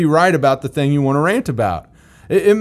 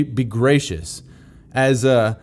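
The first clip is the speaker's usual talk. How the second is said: ordinary talk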